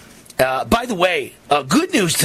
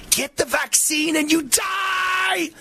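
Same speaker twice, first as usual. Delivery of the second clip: slow, much higher, strained mock cry; shrill mock yell; 13 semitones higher, 3 dB quieter, slower than usual. Host voicing the doctor's hidden meaning, slow high mock yell. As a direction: drag it out like a horror warning. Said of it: "That's what he really means".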